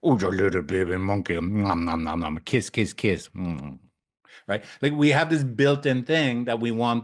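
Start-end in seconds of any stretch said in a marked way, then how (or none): 0.0-3.8 lightly voiced low cooing over a baby monkey, nom nom kisses; hint of doting baby talk, fast.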